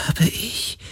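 whispered, sheepish question; verlegen. A narrator's reading. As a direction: sound caught out, embarrassed. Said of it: "Der Geist klang verlegen". (verlegen geflüstert)

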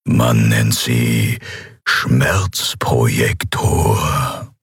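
very slow, low villain voice; unperturbed emperor. A narrator's reading. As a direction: explain with unruffled, deliberate menace. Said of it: "erklärte der Kaiser Endriel unbeirrt".

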